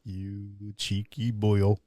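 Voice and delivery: slow, low Welsh grumble; drawn-out, quiet Welsh scolding; mock Welsh accent.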